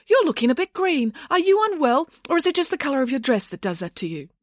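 high, falsely concerned imitation; mimicked rival nanny. Nanny imitating rival's backhanded comments, mock concern. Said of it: "followed it up with a comment like".

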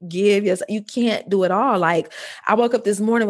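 ordinary talk

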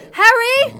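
high, full-force yell. A narrator's reading. Mother calling Harry outside the shack, urgent yell. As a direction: yell out to get his attention. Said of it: "I yelled".

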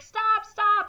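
loud, high-pitched imitated command; urgent mock shout. Flight control to escaping plane, urgent mock shout. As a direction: call out to halt a plane.

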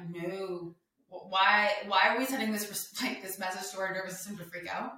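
normal talking voice

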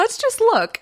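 coaxing little high plea, half laughing; sweet-talking. Retold or imitated. Wife coaxing husband to just look, high playful plea. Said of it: "I was like".

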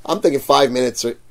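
ordinary delivery

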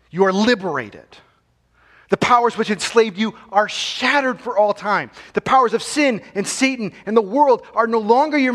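fiery sermon climax, building; preaching at full force. Acted. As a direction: hammer the victory home from the pulpit. Proclaims freedom.

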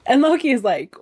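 normal talking voice

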